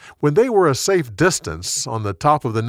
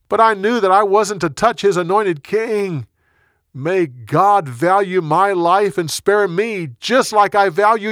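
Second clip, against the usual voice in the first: earnest, high raised voice; calling out; 6 semitones higher, 4 dB louder than usual. A narrator's reading. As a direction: declare it across a distance. David still calling over to Saul.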